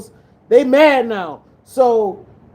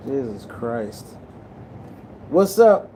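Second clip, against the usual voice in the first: plain reading voice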